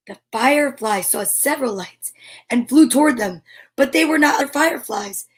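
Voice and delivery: gentle storybook reading; story narration. Acted. Parent reading Eric Carle to a child, gentle storybook voice. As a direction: read the picture book to a little one. Reads picture book aloud.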